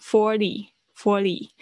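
slow "forty" with a d-like tap for t; flapped T. Shown as a demonstration. Teacher demonstrating the changed sound, slow flapped "forty". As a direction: draw out "forty", softening its t to a flap. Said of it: "那个T变成".

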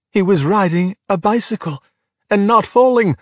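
rising, delighted wonder; high voice, building with excitement; can hardly believe it's working; amazed excitement. A narrator's reading. Narrator voices Luca's delighted surprise.

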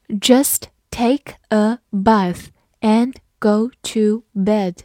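"Just take a bath and go to bed" read very slowly, word by word. Shown as a demonstration. Teacher slowly reading the example sentence to students. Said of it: "我们慢读一遍".

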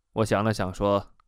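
ordinary delivery, nothing marked

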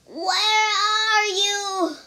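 high, very slow drawn-out wail; groaning. A narrator's reading. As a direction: moan the question in distress. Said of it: "groaned again".

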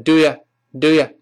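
"do you" said as "d'ya"; reduced "do you" for learners; weakened vowel. Shown as a demonstration. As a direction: let "you" shrink to "ya". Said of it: "变成".

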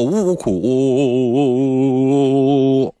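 slow, hammed-up boo-hoo; mock sobbing. Host imitating Flaubert crying at night, mock wailing. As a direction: drag out a fake wail.

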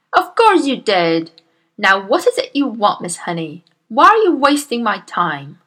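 loud, booming bully of a headmistress; Miss Trunchbull. Acted. Bullying headmistress scolds Miss Honey, booming and loud. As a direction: bark at the timid teacher like a tyrant.

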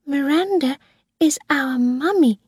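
put-on high, small child's voice; little girl. A narrator's reading. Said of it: "Susan said".

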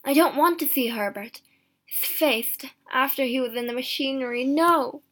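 recoil, building to a loud no; horrified thought. A narrator's reading. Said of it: "he thought".

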